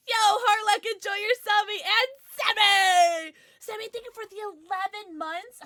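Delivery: high, loud, bubbly thanks to chat; excited shout-out. Real, not acted. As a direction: call the names out with a burst of joy. Excited shout-outs to chat subscribers.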